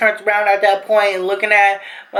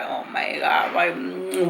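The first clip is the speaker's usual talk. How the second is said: breathy whispered "oh my God" under her breath; a whispered gasp of dread; hushed disbelief; 7 dB quieter, slower than usual. Retold or imitated. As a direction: mutter it, barely voiced, in shock. Stunned reaction while being detained.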